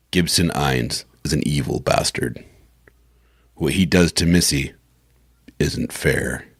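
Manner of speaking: low, measured narration; announcer synopsis read. Acted. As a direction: pause before the last phrase and let it drop. Reads synopsis for recording.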